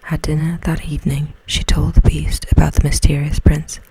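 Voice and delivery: plain reading voice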